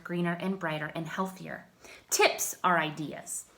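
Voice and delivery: usual speaking voice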